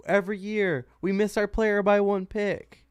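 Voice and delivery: mock a TV pundit complaining; high whiny mock lament; Stephen A imitation. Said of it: "that clip of Stephen A".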